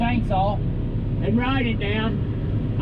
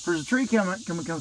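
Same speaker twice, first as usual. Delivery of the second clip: ordinary talk, nothing marked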